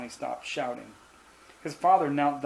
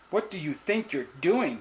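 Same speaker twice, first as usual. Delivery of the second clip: higher-pitched, understated narrated outburst; mildly frantic scolding, swooping up in pitch; hysterical shout; 4 semitones higher than usual. A narrator's reading. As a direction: demand it as an upset mother. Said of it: "his mother was shouting hysterically".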